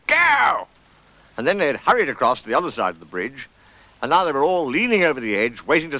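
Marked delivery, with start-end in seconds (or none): 0.1-0.7 high, loud starting shout; a sudden high yell; shouted command. Acted. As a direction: call out the start of a race. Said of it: "when Rabbit said".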